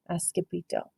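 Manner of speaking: usual speaking voice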